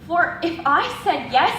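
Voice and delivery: usual speaking voice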